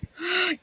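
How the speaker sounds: sharp surprised intake of breath; quick storyteller gasp. Acted. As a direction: gasp as if noticing something.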